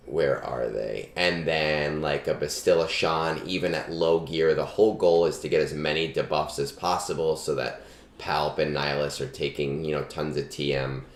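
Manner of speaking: ordinary delivery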